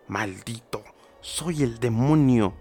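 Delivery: slow, sinister devil voice; demonic voice. Acted. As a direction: speak it as the demon baby. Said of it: "el bebé respondió con voz diabólica".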